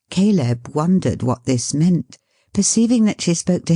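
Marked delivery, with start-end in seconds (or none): none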